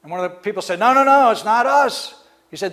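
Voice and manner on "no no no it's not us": a quick, higher, louder no no no; panicked denial. Acted. A courtier pleading with the king, high and loud. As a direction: protest innocence in a rush. Servant denies spying to angry king.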